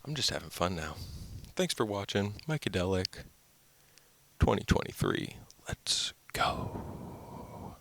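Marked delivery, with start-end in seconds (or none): 5.7-7.7 soft whispered sign-off. Real, not acted. Vlogger closing the video, in a hushed whisper. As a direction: breathe it out as a whisper.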